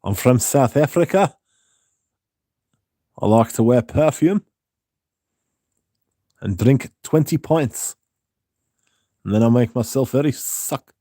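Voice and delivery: put-on botched South African accent, lines lifting at the ends; hammed-up, wobbly South African impression; bad South African. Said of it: "South African would be interesting".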